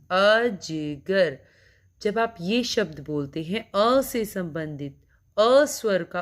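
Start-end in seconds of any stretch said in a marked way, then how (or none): 0.1-1.4 अजगर stretched very slowly, sound by sound; slow drawn-out demo. Shown as a demonstration. Teacher demonstrating अ in अजगर to children, very slow. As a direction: spell out अजगर in slow motion. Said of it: "अ वर्ण का उच्चारण कर रहे हैं".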